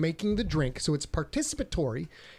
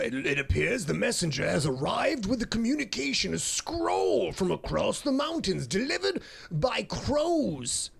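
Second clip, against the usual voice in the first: put-on slow, higher, grand old wizard; old-timey wizard voice; 5 semitones higher than usual. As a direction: proclaim it like an ancient wizard herald. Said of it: "in my old-timey wizard voice".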